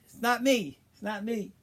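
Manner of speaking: high, loud pleading cry; screaming denial. Retold or imitated. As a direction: cry out your innocence, high-pitched. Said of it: "he's screaming".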